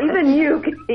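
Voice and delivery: high, loud pitchman voice; infomercial voice. Pretend. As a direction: hype it like a TV ad host. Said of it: "It sounds like an infomercial".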